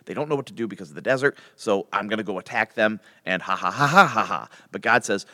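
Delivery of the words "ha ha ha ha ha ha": hammed-up ha-ha-ha of triumph; mock gloating laugh. Preacher playing Pharaoh about to attack, mock gloating. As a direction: put on a gloating villain's laugh.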